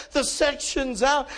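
high, slow, emphatic report of disaster; urgent alarm. Retold or imitated. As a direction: drive each word home in alarm. Stranger breaking the disaster news.

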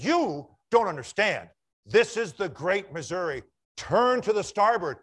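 loud, indignant captain demanding way; angry insistence. Retold or imitated. Captain insisting the light turn, loud and indignant. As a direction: pull rank, loud and offended.

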